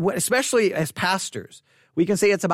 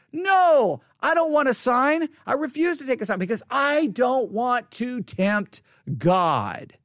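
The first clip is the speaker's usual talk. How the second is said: falsely pious refusal; 5 semitones higher than usual. Acted. Preacher voicing Ahaz refusing Isaiah's offer of a sign, falsely pious. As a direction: refuse grandly, putting on spiritual airs. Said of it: "He tries to come across all spiritual".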